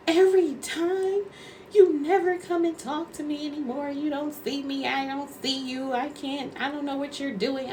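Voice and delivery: high-pitched imitated whine; mock tearful complaint. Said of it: "And she's crying".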